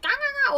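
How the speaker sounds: very high, loud squirrel alarm chatter; squirrel chatter imitation. Explaining animal sounds, loud high squirrel chatter. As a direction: chatter it shrill like an angry squirrel. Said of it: "when the squirrel is like".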